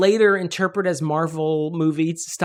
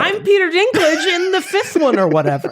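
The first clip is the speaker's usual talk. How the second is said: laughing; 12 semitones higher, 5 dB louder than usual. Real, not acted. Laughing self-deprecating joke.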